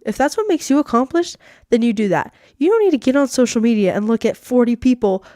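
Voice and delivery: normal talking voice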